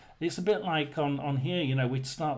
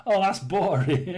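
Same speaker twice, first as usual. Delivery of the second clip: laughing; 3 semitones higher, 6 dB louder than usual. Real, not acted. Laughs at viewer's comment.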